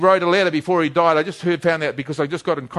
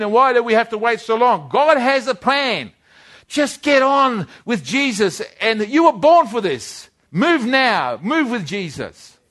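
higher and louder, pressing every why; fervent preaching; 7 semitones higher, 4 dB louder than usual. Acted. Preacher urging his listeners to move with Jesus, impassioned preaching. Preacher rising to full urgency.

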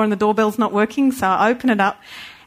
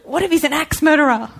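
spooked inner voice; 8 semitones higher than usual. Retold or imitated. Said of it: "I'm starting to think".

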